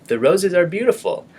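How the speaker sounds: high, lively excited reading; excited exclamation. Shown as a demonstration. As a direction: say it brightly with energy. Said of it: "with a lot of emotion or energy".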